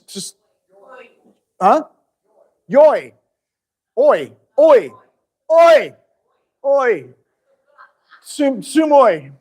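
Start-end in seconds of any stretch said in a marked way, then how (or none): 2.7-7.1 loud, high "Oi" with falling pitch; sounding it out. Shown as a demonstration. Teacher trying out how U O Y is said, loud high sounding-out. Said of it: "How do you say that?". 8.2-9.3 "sumoi" said slowly, high and loud; sounding it out. Shown as a demonstration. Teacher working out an odd slide word, slow loud sounding-out of "sumoi". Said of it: "It's like sumo".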